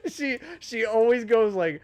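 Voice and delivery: talking through high loud laughter; laughing. Real, not acted. Amused listener describing the narrator, laughing. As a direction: laugh as you explain it.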